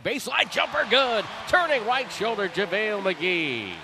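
high, loud burst of play-by-play; excited call. Real, not acted. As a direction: shout the name as it drops. Radio call of a made jumper.

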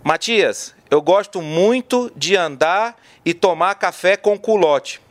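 slow, deliberate, pausing between words; slow with pauses. Shown as a demonstration. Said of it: "falar pausadamente".

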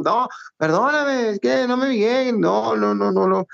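high, slow embarrassed apology; flustered apology. Retold or imitated. Flustered apology to Julio César Chávez.

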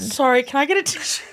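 laughing through tears. Real, not acted. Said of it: "Shan's crying".

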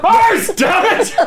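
wild pigeon screech. Pretend. Pigeon call contest, wild attempt.

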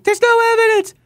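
high squeaky mocking falsetto; Philip Bump impression. Host parroting the columnist's repeated denial, high mocking impression. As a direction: parrot his refrain in a very high caricature. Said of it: "Sorry, he keeps saying".

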